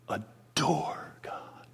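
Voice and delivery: slow, very soft whisper; hushed whisper. Real, not acted. Preacher hushing the congregation about adoring God, whispered slowly. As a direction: breathe the words as if in awe. Tender climax on worship.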